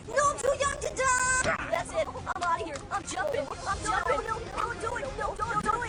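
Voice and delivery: terrified screaming. Acted. Terrified of being killed.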